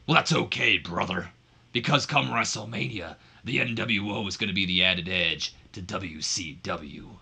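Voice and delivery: lightly voiced wrestling promo; a hint of a wrestler's promo voice; Hulk Hogan. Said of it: "And then Hogan continues".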